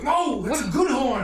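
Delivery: high, loud, delighted correction; proudly insisting the horn is good; excited insistence. Acted. Townsman correcting the party, high and thrilled.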